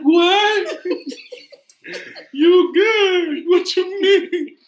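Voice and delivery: very high, loud, hammed-up wail; crying girlfriend. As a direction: imitate a distraught girlfriend shrieking in disbelief. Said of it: "after she cried".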